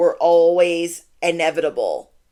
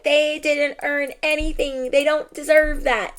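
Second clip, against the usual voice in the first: high-pitched imitation of someone unhinged; mock freak-out; 8 semitones higher than usual. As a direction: mimic them freaking out, pitched way up. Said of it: "literally freaking out, so unhinged".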